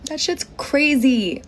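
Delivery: slow, drawn-out disbelief; amazed. Real, not acted. Bartender reacting to the seventy-five thousand dollar tip. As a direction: stretch it out, stunned.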